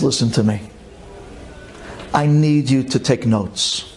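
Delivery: ordinary delivery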